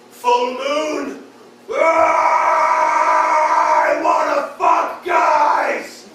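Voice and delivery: howling, high shouted werewolf transformation. Pretend. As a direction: scream it out as the change hits.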